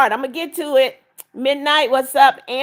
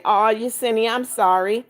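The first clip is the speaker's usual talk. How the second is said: normal talking voice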